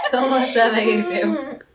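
put-on laughing try at a Cajun accent; half-laughing Cajun accent attempt; Cajun greeting. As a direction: giggle through a mock-Cajun hello. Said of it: "Cajun voice off challenge".

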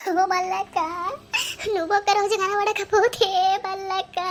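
tearful sing-song lament; crying. Acted. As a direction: sob and call out to her, stretching the name. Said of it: "ఏడవకే". (ఏడుస్తూ రాగం తీయడం)

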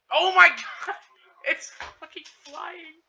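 high amazed yell; excited shout. Real, not acted. Car flies off building.

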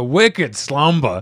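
slow, hammed-up Boston accent; drawn-out Boston vowels, dropped R in "Slumber". Player reading a card name aloud, Boston accent. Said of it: "one says Boston accent".

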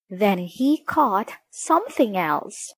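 ordinary delivery

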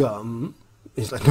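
normal talking voice

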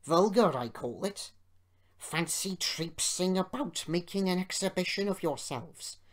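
indignant grumble with punched words; mildly blustering complaint; grumbling. A narrator's reading. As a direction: grumble on in huffy disapproval. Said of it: "he grumbled".

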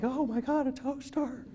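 breathless high gasp of wonder that melts into a laugh; amazed delight. Retold or imitated. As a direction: marvel at a free toaster. Amazed at free toaster.

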